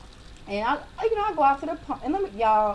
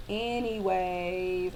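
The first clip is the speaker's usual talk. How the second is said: lightly voiced, very slow car; car dismissing her; 3 semitones lower, much slower than usual. Car ignores her pedal pushing, drawn-out mock dismissal. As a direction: stretch it out dismissively. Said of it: "And it was like".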